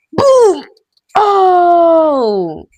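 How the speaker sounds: loud high "Boom"; excited shout. Real, not acted. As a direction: yell it out on the hit. Excited game hit.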